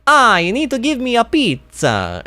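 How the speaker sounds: high, hammed-up Italian accent; cartoonish high-pitched Italian voice; terrible Italian accent. Shown as a demonstration. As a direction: do a bad, over-the-top Italian accent. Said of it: "only way I can do the Italian voice".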